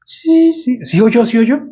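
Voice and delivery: a man pressing his doctor, sure of the sound; eager insistence. Retold or imitated.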